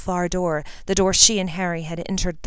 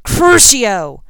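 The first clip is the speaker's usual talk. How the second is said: loud, sharp spell cry; villain's furious spell shout; shouted curse; 5 semitones higher, 11 dB louder than usual. Acted. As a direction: hurl the curse at him. Villain casting torture curse.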